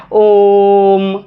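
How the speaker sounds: slow chanted ओम; prolonged Om. Shown as a demonstration. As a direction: chant ओम, stretch the ओ three beats. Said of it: "ओ को भाई तीन मात्रा का समय लगा के पढ़ना है".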